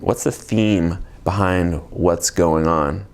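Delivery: ordinary talk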